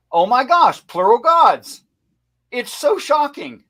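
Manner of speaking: high, loud feigned astonishment; hammed-up sarcastic amazement; mock shock. Host pretending the plural gods are news, mock shocked.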